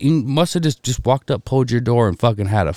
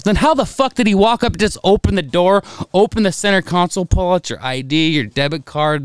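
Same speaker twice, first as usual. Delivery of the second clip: exasperated dad; 7 semitones higher than usual. Retold or imitated. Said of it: "And I was like".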